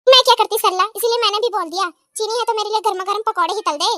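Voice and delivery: ordinary talk, nothing marked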